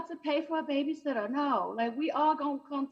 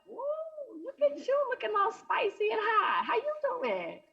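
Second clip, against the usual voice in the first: flirty come-on; 10 semitones higher than usual. Retold or imitated. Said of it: "I was like".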